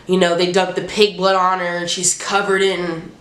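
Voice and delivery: usual speaking voice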